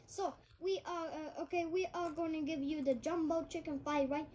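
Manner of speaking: restaurant order-taker with a put-on Chinese accent. Restaurant worker answering a customer, in a Chinese accent. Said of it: "the guy who's taking the order in the restaurant".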